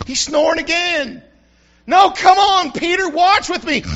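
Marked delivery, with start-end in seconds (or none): 1.9-3.8 louder, higher, urgent plea. Acted. Jesus urging sleepy Peter to stay awake, loud and higher. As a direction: shake him awake with your voice.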